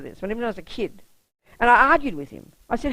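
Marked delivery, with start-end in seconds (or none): none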